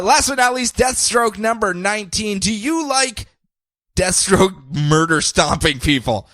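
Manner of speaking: normal talking voice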